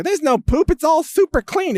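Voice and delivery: high-pitched put-on insistence; mock denier. Politicians denying mess in San Francisco, mock insistence. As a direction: mimic someone absurdly insisting all is fine. Mimics people denying street filth.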